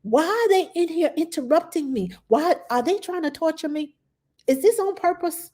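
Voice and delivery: high, pleading cry of frustration; high-pitched distressed venting to herself; upset wail. Retold or imitated. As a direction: whine it out like you're at the end of your rope. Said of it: "I'm like".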